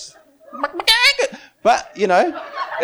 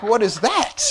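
startled disbelief; 6 semitones higher than usual. Retold or imitated. Retells his startled reaction to wobbling.

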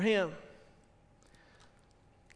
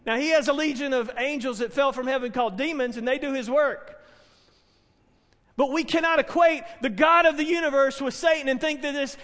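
ordinary delivery

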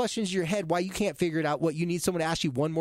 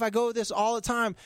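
ordinary delivery

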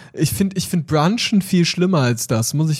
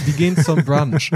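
usual speaking voice

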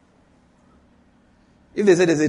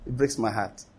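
much softer, trailing off into near silence; soft heartbroken plea; 5 semitones lower, 8 dB quieter than usual. Acted. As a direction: let the repeat fade to a hurt hush. God's plea repeated, fading away.

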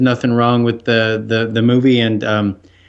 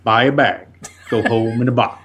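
movie line quote. Retold or imitated. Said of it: "he keeps saying that line".

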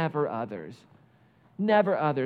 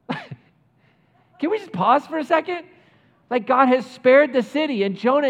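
laughing aside; 9 semitones higher, 8 dB louder than usual. Real, not acted.

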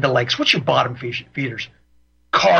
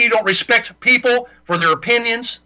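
normal talking voice

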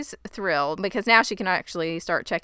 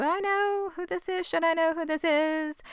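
puzzled high inner voice; 10 semitones higher, 3 dB quieter than usual. Retold or imitated. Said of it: "I was thinking".

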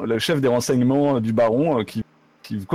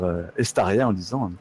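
ordinary delivery

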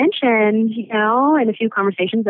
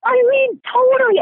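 emphatic agreement. Real, not acted. Kelly agreeing eagerly with the host, very high excited. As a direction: jump in to agree with full force.

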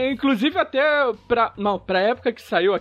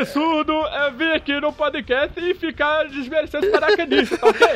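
mock indignant politician; 6 semitones higher than usual. Mock indignant Bolsonaro-style complaint.

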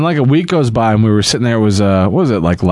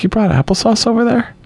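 incredulous; 10 semitones higher than usual. Retold or imitated. Incredulous quote to Becky.

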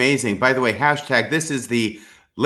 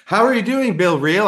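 excited greeting; 7 semitones higher than usual. Real, not acted. Cheerful greeting to cohost.

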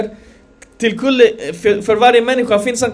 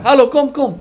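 luring call; 5 semitones higher than usual. Retold or imitated. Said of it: "De ropar".

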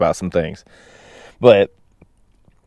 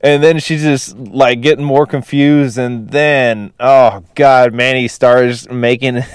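usual speaking voice

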